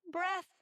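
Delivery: breath lifted high as the big answer; dramatic reveal. Shown as a demonstration. Speaker revealing the answer to the group, playful reveal. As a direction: land the punchline after a drum roll. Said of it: "like, drum roll".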